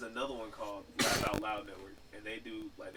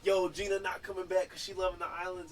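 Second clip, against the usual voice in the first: higher, louder quoted character voice; lightly hammed-up sitcom retelling, pitched up; mock sitcom character; 7 semitones higher, 6 dB louder than usual. Friend retells a sitcom plot to friends, mildly hammed-up and loud. Acting out Martin explaining Gina's absence.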